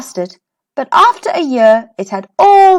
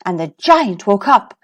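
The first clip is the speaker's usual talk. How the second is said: plain reading voice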